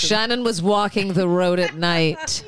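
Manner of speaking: normal talking voice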